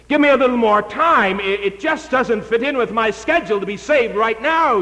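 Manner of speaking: high pleading excuse-maker; whiny stalling voice. Acted. Preacher voices Felix stalling.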